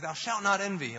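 hammed-up proclamation of a commandment; mock commandment. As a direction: boom it out like a lawgiver. Parodies a commandment given with repetition.

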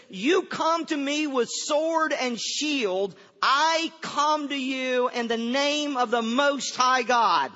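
measured, projected acted defiance; bold proclamation. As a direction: answer the giant with ringing conviction. David's bold answer to the giant.

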